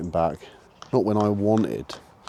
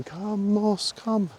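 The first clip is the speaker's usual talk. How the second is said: mock recall shout; 11 semitones higher, 4 dB quieter, much slower than usual. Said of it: "me screaming and shouting".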